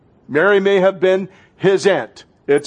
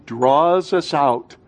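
normal talking voice

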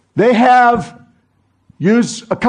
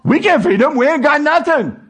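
high, frantic mock disciples; panicked disciples; 6 semitones higher than usual. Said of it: "They go".

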